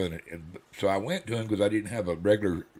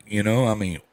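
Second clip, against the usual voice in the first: ordinary delivery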